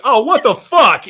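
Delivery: high, loud startled yell; mock shocked yell. First overseer walking in, loud shocked yell. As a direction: shout it like you just walked in on something.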